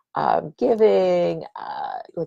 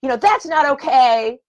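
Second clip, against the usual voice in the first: higher, louder indignant snap; angry retort; 7 semitones higher, 5 dB louder than usual. Retold or imitated. Showing the reactive way she might answer, higher and louder indignant snap. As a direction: put the other person in their place. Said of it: "rather than go".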